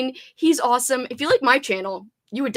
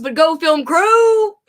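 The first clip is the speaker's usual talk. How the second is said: cheer; 4 semitones higher, 9 dB louder, slower than usual. Real, not acted. Cheering her team.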